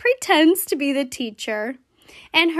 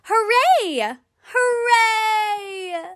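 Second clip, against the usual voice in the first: cheerful shout; 7 semitones higher, much slower than usual. Pretend. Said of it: "clap your hands and shout".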